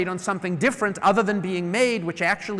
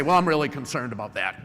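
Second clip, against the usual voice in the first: lower, curt concern, no praise first; blunt criticism demo; 6 semitones lower than usual. Shown as a demonstration. Manager confronts an employee bluntly, curt and lower. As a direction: go straight to the blunt complaint. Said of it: "If you just walk in and you say".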